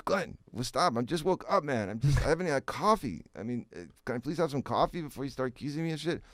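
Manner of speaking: high, pleading, just woke up; exasperated protest. Retold or imitated.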